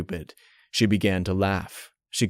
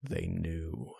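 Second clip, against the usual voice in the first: hushed; 3 semitones lower, 12 dB quieter than usual. A narrator's reading. Horror realization.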